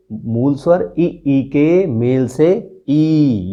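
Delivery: ई stretched very slowly, each one held; drawn-out vowels. Shown as a demonstration. Teacher sounds out ई for students, very slowly. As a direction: hold each ई long and clear.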